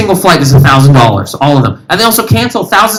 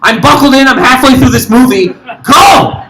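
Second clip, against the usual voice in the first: outraged passenger; 6 semitones higher than usual. Acted. Acted outraged stuck passenger.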